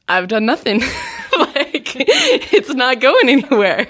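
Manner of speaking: sheepish words breaking into laughter; real giggle between the phrases; laughing admission. Real, not acted. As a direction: laugh through the admission, dragging the end. Admits no progress, laughing.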